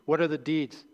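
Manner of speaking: ordinary delivery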